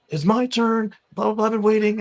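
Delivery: high-pitched mock tantrum; retold ballistic rant. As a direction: imitate an irate man demanding his place. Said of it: "he was going ballistic on this".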